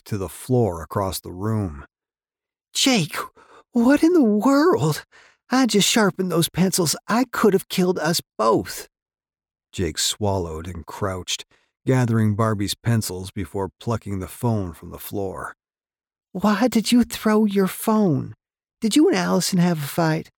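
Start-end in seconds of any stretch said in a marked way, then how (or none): 2.7-8.9 high, startled woman's voice. A narrator's reading. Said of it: "Barbie shrieked". 16.3-20.3 high, worried woman's voice; concerned woman. A narrator's reading. Barbie questions Jake, concerned.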